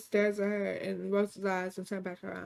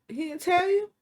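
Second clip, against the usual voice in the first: high, loud mock surprise; acted-out gloating "didn't he tell you?"; taunting question; 8 semitones higher, 5 dB louder than usual. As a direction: rub it in with a raised, rising question. Mistress taunts wife with engagement news.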